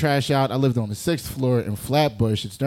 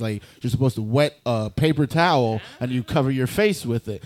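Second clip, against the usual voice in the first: usual speaking voice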